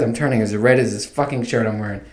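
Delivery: usual speaking voice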